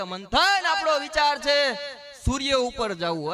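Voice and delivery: a friend bursting with a crazy plan; excited friend. Retold or imitated. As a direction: pitch a big dream with zeal. Friend's grand plan voiced.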